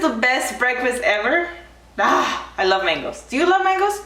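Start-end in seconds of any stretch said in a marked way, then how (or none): 3.2-4.0 high, eager question; bright excited ask. Real, not acted.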